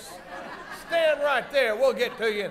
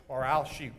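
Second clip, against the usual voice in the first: dropped low and softer after the shout; hero's threat; 8 semitones lower, 6 dB quieter than usual. Shown as a demonstration. Hero threatening the villain, suddenly low and quieter. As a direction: undercut the yell with a low aside. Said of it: "yell out".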